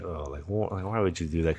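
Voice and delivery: put-on quiet girlfriend. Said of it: "she was like".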